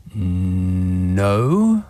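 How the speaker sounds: drawn-out NO, low then rising at the end; mock pondering. Host mulling over whether that makes a sport, mock pondering. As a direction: draw NO out as if still weighing it up. Pondering the question before answering.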